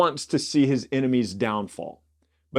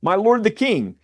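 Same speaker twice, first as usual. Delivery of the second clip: raised, higher call to get his attention; 10 semitones higher, 5 dB louder than usual. A narrator's reading. David calling after Saul from the cave, raised voice. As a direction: call out after him across the distance. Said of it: "called after Saul".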